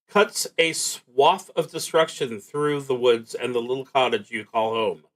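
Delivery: ordinary talk, nothing marked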